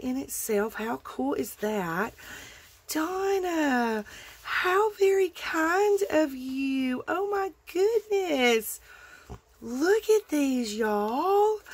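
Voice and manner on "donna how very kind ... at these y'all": high, slow, drawn-out thanks; overwhelmed with delight; delighted gushing. Real, not acted. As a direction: gush over the gift, slowly. Thanking the sender for gifts.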